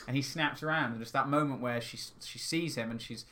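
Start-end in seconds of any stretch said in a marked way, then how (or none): none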